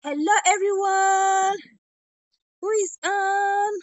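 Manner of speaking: loud, slow call to the viewers. Real, not acted. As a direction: call out to everyone watching. Calling out to live viewers.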